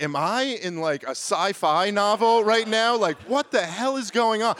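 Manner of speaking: incredulous outburst, pitch jumping high; getting furious. Retold or imitated. Guest answers the hotel manager's odd question, furiously incredulous. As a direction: act out mounting fury in a high-pitched voice. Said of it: "I was just getting furious".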